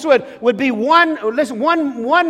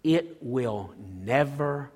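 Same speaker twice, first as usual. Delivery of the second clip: much softer and lower, slowing into a near hush; hushed promise; 7 semitones lower, 7 dB quieter than usual. Real, not acted. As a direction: draw them in close with a quiet vow. Hushed promise to young people.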